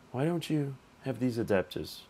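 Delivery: ordinary talk, nothing marked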